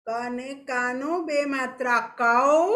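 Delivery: ક અને કાનો બે માત્રા કૌ, slow and stretched, rising on કૌ; slow spelling aloud. Shown as a demonstration. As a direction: dictate it slowly to the class, rising on કૌ. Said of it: "બોલી બોલીને ધીરે ધીરે લખો". (બાળકોને ધીમે ધીમે બોલાવતી શિક્ષિકા)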